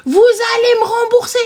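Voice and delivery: high, insistent imitation; mock indignant client demand; demanding caller. Client demands reimbursement from agent, high and insistent.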